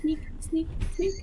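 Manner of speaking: sneaky whisper. Pretend. Playful sneaking chant.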